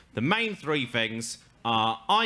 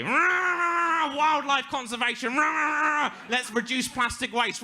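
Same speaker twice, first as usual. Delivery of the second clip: put-on drunk Attenborough shout; 11 semitones higher, slower than usual. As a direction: bellow it like a boozy nature presenter. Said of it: "his voice would be".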